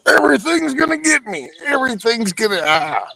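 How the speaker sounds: high, frantic scaredy-cat squeal; panicky dog voice. Retold or imitated. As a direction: voice the terrified dog. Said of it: "outside that cage".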